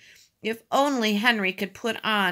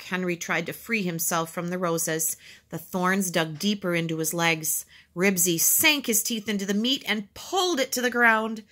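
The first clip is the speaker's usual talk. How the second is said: ordinary delivery, nothing marked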